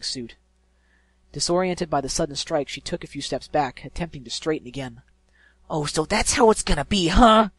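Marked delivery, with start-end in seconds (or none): 5.7-7.5 loud, higher furious taunt; ready to fight; screamed. Acted. Injured pegasus screaming at the griffin, furious. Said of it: "Rainbow Dash screamed".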